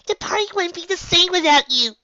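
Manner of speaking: cling on and beg; high Pinkie voice. A narrator's reading. Pinkie Pie to Applejack, high tearful.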